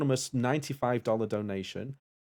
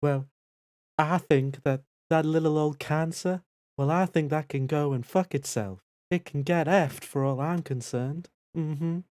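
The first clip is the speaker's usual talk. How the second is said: a hint of a sweet Southern lady; mild mock Southern belle accent; 4 semitones higher than usual. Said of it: "as a Southern belle".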